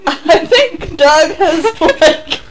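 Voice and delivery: slow, choked with laughter; can barely get it out for laughing. Real, not acted. Group laughing fit.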